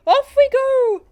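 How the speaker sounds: high, loud launch cry; exuberant call. Acted. Balloonist lifting off into the sky, calling out exuberantly. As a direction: call it out as you take off.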